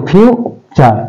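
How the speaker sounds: slow future with a gap after ফিউ; syllable by syllable. Shown as a demonstration. Teacher pointing out two syllables to students, future divided. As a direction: break future into ফিউ and চার. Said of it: "ফিউ একটা".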